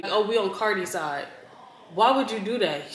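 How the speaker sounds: usual speaking voice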